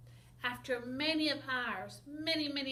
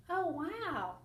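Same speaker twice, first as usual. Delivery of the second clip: ordinary delivery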